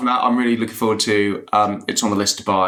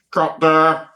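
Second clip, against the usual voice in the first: a touch higher and louder, like a first AD on a megaphone; imitating the first AD calling the cut over a megaphone, mildly raised; 7 semitones higher, 4 dB louder than usual. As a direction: call the cut as if through a loudhailer, lightly mocked. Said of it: "go through the megaphone".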